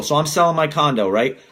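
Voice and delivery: ordinary talk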